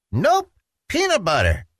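put-on high, insistent little boy; stubborn child insisting; insistent Petey. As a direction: plant your feet and insist like a kid.

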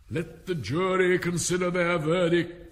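high, loud royal order; proclaiming King. A narrator's reading. King ordering the jury to its verdict, loudly proclaiming. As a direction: proclaim it to the whole court.

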